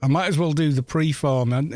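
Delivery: normal talking voice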